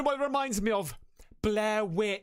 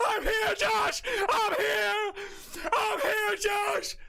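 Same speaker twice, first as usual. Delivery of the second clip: crying scream; 9 semitones higher, much slower than usual. Retold or imitated. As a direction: scream back in desperate tears. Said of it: "goes to the other camera".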